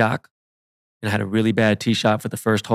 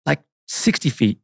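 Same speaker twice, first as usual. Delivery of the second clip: ordinary delivery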